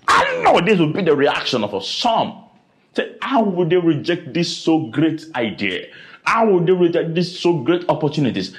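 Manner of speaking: ordinary talk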